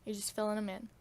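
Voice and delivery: usual speaking voice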